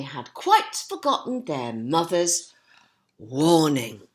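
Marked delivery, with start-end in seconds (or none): none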